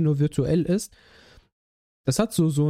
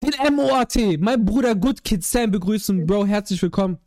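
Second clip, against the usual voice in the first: hype announcer; 4 semitones higher, 3 dB louder than usual. Acted. Hyped guest intro.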